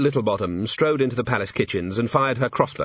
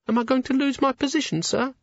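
put-on high, timid servant girl; nervous young maid. A narrator's reading. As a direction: stammer it out, frightened. Maid voiced.

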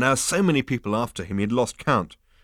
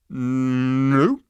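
loud, higher, drawn-out no; emphatic denial; 5 semitones higher, 7 dB louder than usual. Acted. Ford corrects Arthur about the universe, emphatically. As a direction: stretch out the no.

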